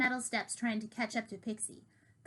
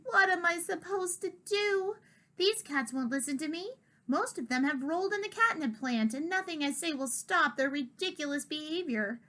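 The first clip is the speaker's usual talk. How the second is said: put-on old cat in despair; 6 semitones higher than usual. Diggory despairs.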